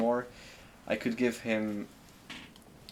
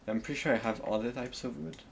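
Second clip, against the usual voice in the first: ordinary delivery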